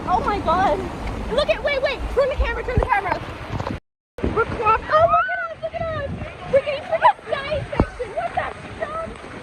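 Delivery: high, loud, panicked chatter; frantic in the rain. Real, not acted. Filming herself soaked in rain, frantic high shouting. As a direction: yell over the storm in a rush.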